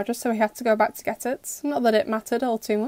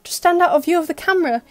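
louder, higher annoyed ranting; exasperated rant; 6 semitones higher, 7 dB louder than usual. A narrator's reading. Adrian ranting at Felix about hiding under the bed, loud. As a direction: keep yelling in disbelief.

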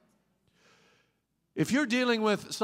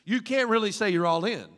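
ordinary delivery

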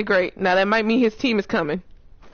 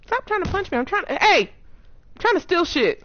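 indignant yelling; 9 semitones higher than usual. Real, not acted. Enemy punching her.

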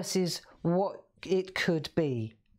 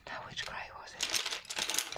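whisper; 8 dB quieter than usual. Real, not acted. Muttering to herself looking for pen.

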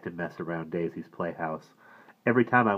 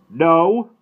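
a louder, disgusted no; 8 semitones higher, 10 dB louder than usual. A narrator's reading. Father answers mother's joke, loud and disgusted. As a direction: brush off the idea in disgust. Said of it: "Papa said in a disgusted voice".

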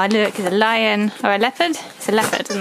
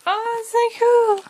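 much higher, bright oh so cool; thrilled exclamation; 11 semitones higher than usual. Real, not acted. Opening a birthday gift for the dog, thrilled and high. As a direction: gush over the present.

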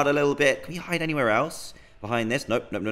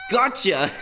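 playful gotcha; 7 semitones higher, 4 dB louder than usual. Acted. Jump scare on NPC.

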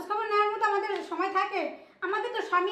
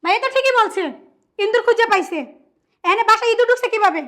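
indignant, raised-voice exclaiming; loud outrage; 7 dB louder than usual. Acted. Woman backs brother after finding intruder, loud outrage. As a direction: exclaim loudly in scandalised anger.

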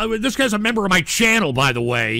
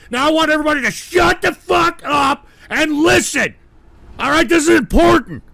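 high, forceful scolding shout; angry insistence; 6 semitones higher, 4 dB louder than usual. Real, not acted. Streamer insisting the broadcast matters, angry shouted order. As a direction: demand silence with rising exasperation.

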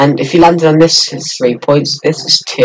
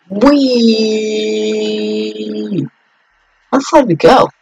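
excited whee; 7 semitones higher, slower than usual. Pretend. Boat launch.

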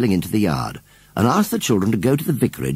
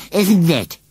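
high, louder, bright little reply; cheerily; 8 semitones higher, 5 dB louder than usual. Acted. Scarecrow answers Susan's pity, cheerily. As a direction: toss it off cheerfully, oddly unbothered. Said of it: "replied Gummidge cheerily".

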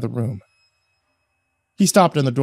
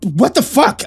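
sharp, raised, shocked outburst; startled cry; 9 semitones higher, 7 dB louder, slower than usual. A narrator's reading. Narrator wakes to find Steve with a pistol, shocked cry. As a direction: blurt it out in alarm.

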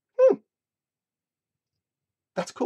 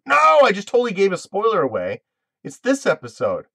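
higher and louder, caught out by her own slip; dismayed realization; 8 semitones higher, 7 dB louder than usual. Real, not acted. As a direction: blurt it as you realize the spoiler. Realizes she spoiled the episode.

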